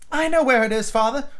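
put-on eager high-pitched child voice; Wendy crying out. A narrator's reading. Daughter offers to fetch father's medicine, high eager cry. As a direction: pipe up high as a helpful girl. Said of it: "Wendy cried".

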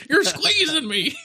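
high, laughing squeezed cry; being squeezed hard. Retold or imitated. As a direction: squeal like he's pinned in a door. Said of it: "tries slamming the door".

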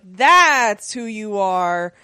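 THAT'S drawn out and loud; slow, loud "That's", acted as an accusation; accusing emphasis. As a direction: imitate an accusation, leaning long and hard on "That's". Retold accusation in an argument.

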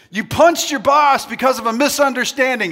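high, loud game-host hype; hyped-up shout. Real, not acted. Preacher testing crowd on punching the boss, hyped-up shout. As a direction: throw it out loudly to the crowd.

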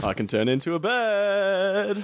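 high, drawn-out silly god voice; goofy exaggerated echo of a boast; mock Zeus. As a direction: stretch it slowly up high, hammed-up.

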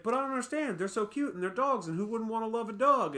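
high, innocent pleading; puzzled kid who can't believe it; high-pitched naive elf question; childlike elf voice. Retold or imitated. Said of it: "And he's like".

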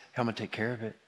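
Jesus comforting someone in their fear, softly; gentle Jesus. Acted. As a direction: soothe a frightened child. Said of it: "He goes".

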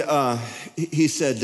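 ordinary talk

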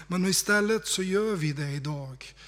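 ordinary talk, nothing marked

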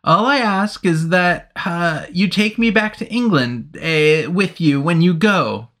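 imploring. Acted. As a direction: beg to be taken home. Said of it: "he implored".

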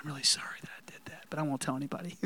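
quick whispered mock apology; under the breath. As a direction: mumble a secret apology under your breath. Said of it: "not under our breath".

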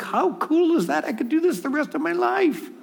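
high, giddy wonder; delighted. Retold or imitated. Preacher voicing delight at watching God's gifts open, high and giddy. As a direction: sound thrilled at the sight. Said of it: "We go, like".

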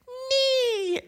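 tiny high-pitched squeak of a nee; thin high nose-whistle nee; high whistling nee. Retold or imitated.

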